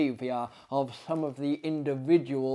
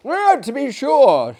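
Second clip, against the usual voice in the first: much higher, louder eager assent; hasty agreement; 11 semitones higher, 11 dB louder than usual. Acted. The guest placating Maillard, high eager assent. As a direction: agree quickly to smooth things.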